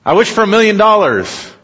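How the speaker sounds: high, loud, greedy demand; greedy wish. Retold or imitated. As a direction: shout the wish with glee.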